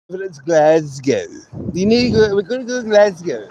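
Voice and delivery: mock-posh accent, Glasgow as "Glaz-gow"; posh toff accent. Mimicking how the toffs say Glasgow, posh accent. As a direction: put on a toff's accent, stretching Glasgow. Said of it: "for the toffs and whatever".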